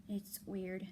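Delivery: ordinary talk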